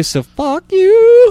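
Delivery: high, slow, squeaky taunt; mock tough local. Mocking local tough guy.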